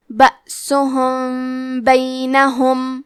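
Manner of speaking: بأسہم بینہم very slow, the meem hidden behind the با with nasal hum; hidden meem with ghunna. Shown as a demonstration. Teacher showing ikhfa to students, slow. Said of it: "چھپاتے ہوئے اور غنہ کے ساتھ".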